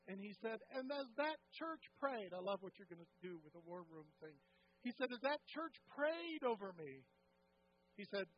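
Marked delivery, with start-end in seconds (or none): 0.7-2.3 emphatic retelling. Retold or imitated. Pastor's testimony, emphatic retelling. 5.1-7.1 emphatic retelling. Retold or imitated. Pastor's testimony, emphatic retelling.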